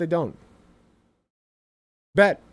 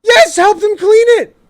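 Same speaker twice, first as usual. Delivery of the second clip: a sudden yell, much higher and much louder; bursting out in delight, shouting; excited shout; 12 semitones higher, 11 dB louder, slower than usual. Real, not acted. Host yells at co-host's parenting idea, excited.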